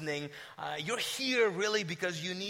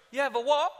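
high British query; British accent, rising in disbelief; puzzled British woman; 5 semitones higher than usual. Retold or imitated. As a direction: imitate a baffled British receptionist. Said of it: "She's British".

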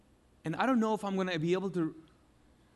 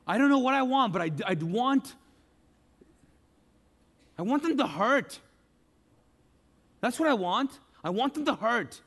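louder, higher, seething want for revenge; mock vengeful outburst; 4 semitones higher, 4 dB louder than usual. Preacher acting out a churchgoer craving revenge, louder angry outburst. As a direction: build up into a bitter cry for revenge. Voicing a grudge, rising to fury.

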